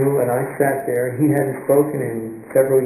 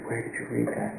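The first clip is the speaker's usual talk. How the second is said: hushed question; 10 dB quieter than usual. Retold or imitated. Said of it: "And he said".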